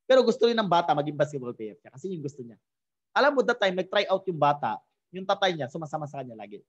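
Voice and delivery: ordinary delivery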